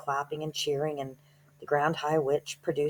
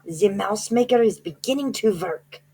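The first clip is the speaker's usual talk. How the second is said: higher, louder witch shriek; 6 semitones higher, 5 dB louder than usual. Acted. Grand High Witch shrieking to the audience that the alarm went off.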